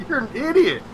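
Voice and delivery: mocking insult. Retold insult to manager.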